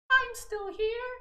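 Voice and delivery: tiny squeaky falsetto, words drawn out; Blender. Acted. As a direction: pipe up slowly in a very high cartoon voice. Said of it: "Get outta here, Blender".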